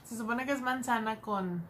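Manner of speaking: talking between bites of snack; eating a crunchy snack; mouth partly full. Real, not acted. Seen 0: Holds twisted snack at lips, bites between words, mouth working.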